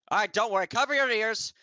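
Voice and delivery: high, loud call to cover ears; loud warning. Acted. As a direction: shout the warning up to the group. Archmage warns before blast.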